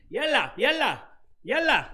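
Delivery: high, loud calling out; Egyptian street caller. Retold or imitated. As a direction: holler like an Egyptian hawker. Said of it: "I heard him".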